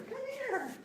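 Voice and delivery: high-pitched coaxing call. Real, not acted. Trainer calling the puppy in a high voice. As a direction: call her in sweet and high.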